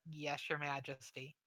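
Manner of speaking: soft, subdued guard's reply; uncomfortable guard. Acted. Uncomfortable guard answering his king, subdued and soft. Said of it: "the guard looks really uncomfortable".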